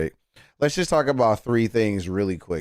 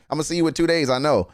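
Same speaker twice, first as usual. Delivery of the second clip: ordinary talk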